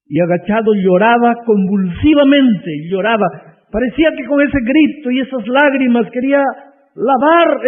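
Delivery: full-force preaching climax, slowing at the end. Acted. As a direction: stretch out the words to drive them home.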